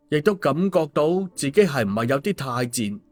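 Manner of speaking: plain reading voice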